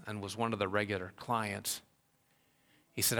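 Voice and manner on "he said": ordinary talk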